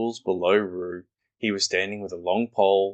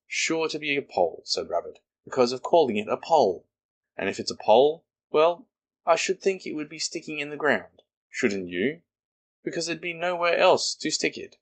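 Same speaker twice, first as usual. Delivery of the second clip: higher, fussy little Rabbit voice; brisk Rabbit, higher pitched; 8 semitones higher than usual. Acted. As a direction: play Rabbit puzzled and busy. Rabbit's voice in story.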